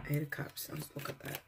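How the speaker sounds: soft whisper to herself; barely voiced, just for yourself; hushed whisper; whispered aside. Real, not acted. Turning over a card.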